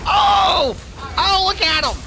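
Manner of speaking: high, loud shouting; thrilled shout watching a teammate; excited shout. Real, not acted. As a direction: yell at the screen in delight. Excited at the game.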